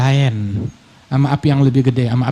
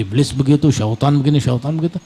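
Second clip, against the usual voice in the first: usual speaking voice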